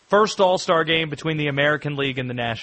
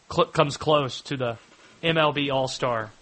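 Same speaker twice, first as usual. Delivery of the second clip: ordinary talk, nothing marked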